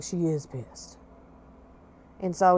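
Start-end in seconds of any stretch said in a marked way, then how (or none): 0.0-1.0 put-on child quietly confirming mom is angry; hushed reply. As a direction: lower and much softer. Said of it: "I was like".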